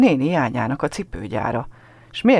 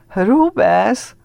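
laughing at it; 7 semitones higher, 5 dB louder than usual. A narrator's reading. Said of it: "nevet ki a gyerek".

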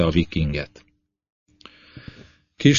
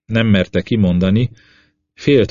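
ordinary delivery

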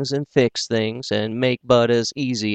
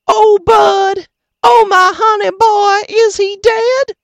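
very high, birdlike cry; chirping; 11 dB louder than usual. A narrator's reading. Mother running to her wounded son, chirping very high. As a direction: chirp like a little wren, frantic.

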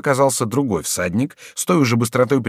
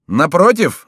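raised, loud retort; loud reply; 8 semitones higher, 7 dB louder, slower than usual. A narrator's reading. Beset friend answering the rider, loud voice. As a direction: answer back across a crowd. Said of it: "отвечал громким голосом Леваро".

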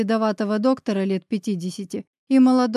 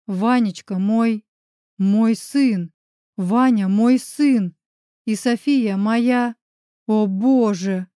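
breathless mumbling; 4 dB louder, slower than usual. A narrator's reading. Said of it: "задыхаясь и пытаясь осознать невозможное, забормотал я".

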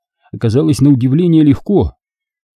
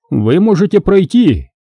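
higher-pitched compliant echo; obedient repetition; 8 semitones higher than usual. A narrator's reading. Guard letting the Jedi pass, obediently. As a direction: parrot the suggestion, pitched up. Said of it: "послушно повторил охранник".

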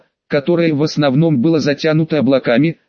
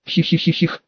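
soft sinister cackle; cackling hell torturer; 4 dB quieter than usual. A narrator's reading. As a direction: cackle quietly like a wicked old bartender. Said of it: "усмехнулся бармен".

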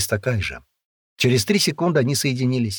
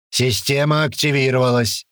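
drill sergeant; 4 semitones higher than usual. A narrator's reading. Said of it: "пояснил комендор-сержант".

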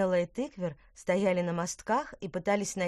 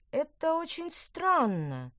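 slow, higher, musing; thoughtful; 9 semitones higher, slower than usual. Acted. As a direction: say it slowly, puzzling it over. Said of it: "задумчиво произнесла Петронелла". (задумчиво и медленно)

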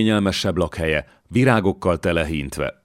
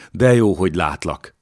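ordinary delivery

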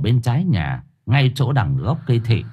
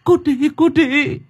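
high, stammering terrified cry; tearful panic; 13 semitones higher than usual. Acted. Yến confronts two ghost children after her baby, tearful and panicked. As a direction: stammer it out high, close to tears. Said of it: "Yến nghe thế vậy thì mếu máo".

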